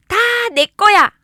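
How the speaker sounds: loud, high, possessive outburst; greedy child's shout. Acted. Greedy child claiming it all, loud high shout. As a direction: shout it like a child grabbing everything.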